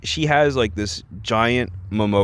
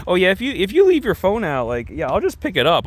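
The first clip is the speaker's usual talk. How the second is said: mock agreement; 9 semitones higher than usual. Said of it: "They're both like".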